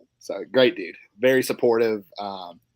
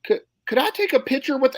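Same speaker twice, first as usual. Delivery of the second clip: lady voice; 13 semitones higher, 4 dB louder than usual. Retold or imitated. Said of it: "and then she goes".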